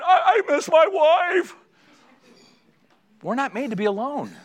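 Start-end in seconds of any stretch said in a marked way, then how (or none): 0.0-1.5 very high, loud, drawn-out blubber; hammed-up weepy squeal; mock sobbing wail. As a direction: wail like a homesick big baby. Burly man wailing for his wife.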